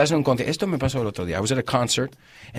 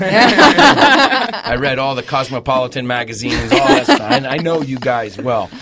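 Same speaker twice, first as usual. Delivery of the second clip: laughing boast; 7 dB louder, slower than usual. Real, not acted.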